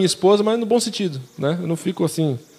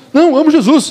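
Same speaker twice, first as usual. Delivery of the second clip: put-on indignant teen; 9 semitones higher, 6 dB louder than usual. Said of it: "chegava pra mim e falou".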